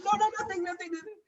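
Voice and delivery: high, hushed denial fading to almost nothing; a boy hiding the hurt, brushing it off in a high small voice; imitating brother's denial. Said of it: "He's like".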